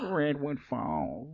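slow, drawn-out mock-American "Redwood Falls"; mock American accent. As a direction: stretch out "Redwood Falls" in a put-on American twang. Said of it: "map program".

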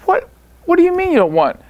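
high, incredulous comeback; retold disbelief. Coach answering a referee who wants no part, incredulous. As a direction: replay being baffled, pitch shooting up.

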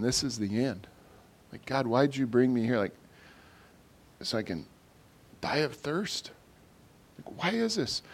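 soft, disheartened questioning of God; mild acted despair. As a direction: voice a weary complaint to God, quietly. Voices despairing Israelites questioning God.

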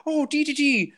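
put-on a failed stab at a British accent. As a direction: try a British accent and miss. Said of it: "No, that's not a British accent".

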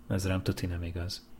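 quiet joking aside to herself; muttered aside. Real, not acted. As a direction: drop the voice, a wry personal comment. Joking aside about being sleepy. (halk, tréfás félremondás)

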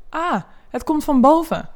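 loud, high-pitched exclamation of discovery; bystander calling out. A narrator's reading. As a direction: call it up from the street. Bystander on the street calls out.

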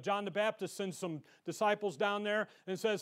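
normal talking voice

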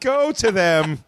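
slow, high, hammed-up urging; mock dramatic farewell. Mock owner releasing pet cats, theatrical farewell. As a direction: draw it out like a grand goodbye.

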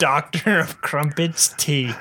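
put-on slow, relishing parody British accent; mock British accent. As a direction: echo the silly British accent slowly.

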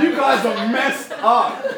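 high, loud, slow laughing disbelief. Real, not acted. Preacher teasing the congregation, laughing high and loud. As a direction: laugh it out at them.

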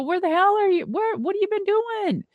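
high, delighted disbelief; Deuce, thrilled to hear from Mike, high and eager; retold excited surprise. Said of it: "I go".

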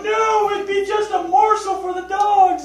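high, slow shouted protest; family loudly refusing to release the bunny; kids' loud protest. Retold or imitated. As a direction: cry out against it like the kids did. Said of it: "and they're like".

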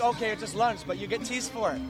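normal talking voice

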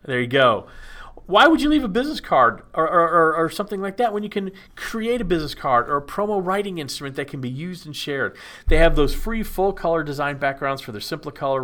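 upbeat ad read with a showman's pitch; kids party magician. Acted. Ad read for Gold Star.

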